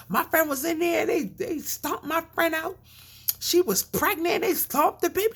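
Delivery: high, mock-outraged cry; hammed-up horror to scare off shoppers; fake outrage. Protester to shoppers, high hammed-up outrage.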